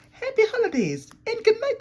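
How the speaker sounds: high, fast, loud holiday farewell; excited sign-off. Acted. Host closing the holiday show, high and excited. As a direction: burst out with the goodbye.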